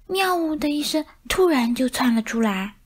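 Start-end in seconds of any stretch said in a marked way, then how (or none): 0.1-0.6 a high drawn-out meow. Acted. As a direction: meow like a startled cat. Cat's meow in the story. (猫叫一声喵呜)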